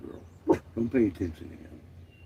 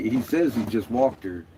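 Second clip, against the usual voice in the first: normal talking voice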